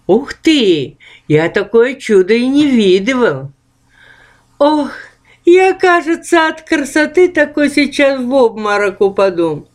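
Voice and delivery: high, eager ghostly chatter; excited ghosts. Acted. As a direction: gush over her like delighted ghosts. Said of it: "говорили наперебой привидения".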